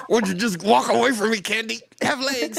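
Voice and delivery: high-pitched begging voice; pleading high voice. Acted. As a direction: plead with the candy bag. Pleading with the candy bag.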